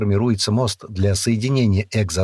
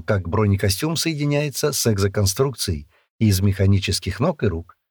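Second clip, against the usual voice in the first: ordinary delivery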